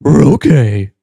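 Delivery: cartoon dog Scooby-Doo impression. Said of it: "I can kinda do Scooby-Doo".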